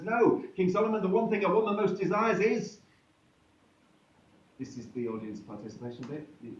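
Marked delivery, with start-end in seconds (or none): 0.0-2.8 another woman. Acted. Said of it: "Up stands another woman and says".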